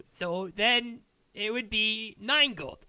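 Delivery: high, slow dwarf voice, totting up; calculating dwarven smith. Acted. Dwarf smith telling the buyer the total, high and slow. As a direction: announce the sum slowly.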